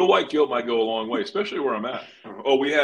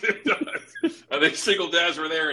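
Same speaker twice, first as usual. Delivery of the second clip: laughing; 8 semitones higher than usual. Real, not acted. Laughing at joke.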